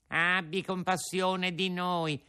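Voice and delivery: high, pleading whine; sly fox begging in a whimper; whining fox. Acted. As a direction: beg pitifully, fox style.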